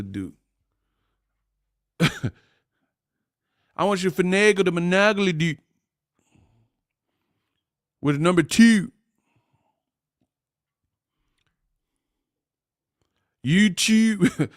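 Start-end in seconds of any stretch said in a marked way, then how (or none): 3.8-14.5 high mock Baltimore drawn "oo"; Baltimore accent. Host mimicking Baltimore locals who sound British, high mock Baltimore accent. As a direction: ham up the Baltimore vowels, pitched up. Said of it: "they be sounding British or something".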